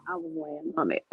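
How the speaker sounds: giggling through the words; laughing. Real, not acted. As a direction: laugh it off sheepishly. Embarrassed laugh about mic.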